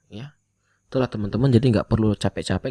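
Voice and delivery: normal talking voice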